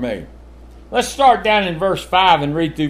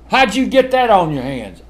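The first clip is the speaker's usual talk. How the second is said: raised scolding parent voice; parent questioning a child; 4 semitones higher, 4 dB louder than usual. Retold or imitated. Parent asking child about messy room, mildly stern. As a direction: demand an answer from a messy kid. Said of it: "what you always ask kids".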